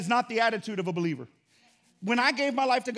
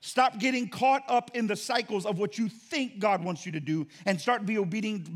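ordinary talk

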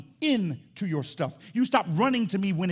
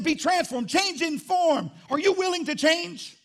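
high, drawn-out pulpit cry; forceful preaching; 13 semitones higher, 5 dB louder, slower than usual. Real, not acted. Preacher challenging the church to change, forceful preaching. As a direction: call the congregation out, slow and high.

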